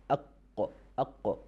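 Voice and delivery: "aq" with a stopped q and a small echo; qaf demo. Shown as a demonstration. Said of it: "that is the pronunciation of letter qaf".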